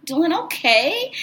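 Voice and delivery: slow, high, puzzled reply; confused. Retold or imitated. Answering the suddenly friendly representative, confused. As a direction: answer as if unsure what's going on. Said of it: "I'm kinda confused".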